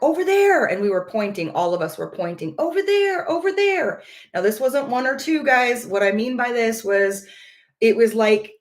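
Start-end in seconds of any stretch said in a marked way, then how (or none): 0.0-0.7 high, eager call of over there; excited pointing. Retold or imitated. Wife spotting lights, excited. 2.6-4.0 high, eager repeated calls; all pointing, caught up in it; excited pointing. Retold or imitated. Everyone pointing at lights.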